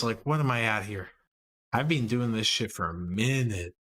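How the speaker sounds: ordinary talk, nothing marked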